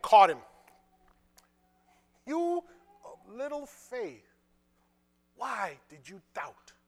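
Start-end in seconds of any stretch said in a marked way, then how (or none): 2.3-6.7 slow, soft, drawn-out chiding; lightly acted, lingering rebuke; gentle reproach. Acts Jesus gently chiding Peter.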